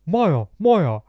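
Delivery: put-on loud, high, drunk-lad voice; mocking impression of Jeevan. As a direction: ham up a drunk mate on the phone. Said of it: "this isn't how he sounds".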